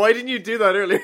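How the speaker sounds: loud, high exclaimed complaint with a laugh; mock outraged question. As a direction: imitate an exasperated friend demanding an answer. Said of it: "It's like".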